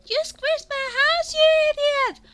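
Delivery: very high, very loud outraged squeal; furious creature shout. Acted. Brownie shrieks at the hero who squished his house, furious. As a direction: scream it at the giant who crushed your home.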